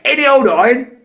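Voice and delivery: high, loud cockney sing-song cry; cockney street cry. Retold or imitated. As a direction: belt it out like a cockney street seller. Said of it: "That was so cockney".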